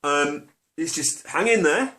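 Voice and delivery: breezily fob him off, climbing up into a high far voice; breezy brush-off. Retold or imitated. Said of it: "You'd think he'd go".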